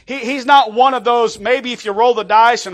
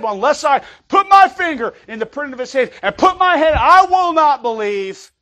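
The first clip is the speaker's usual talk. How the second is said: Thomas; 5 semitones higher than usual. Retold or imitated. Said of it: "Remember what Thomas said?".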